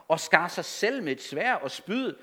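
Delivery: normal talking voice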